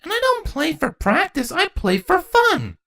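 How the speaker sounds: put-on high, insistent child; pouty kid correcting; little girl voice. A narrator's reading.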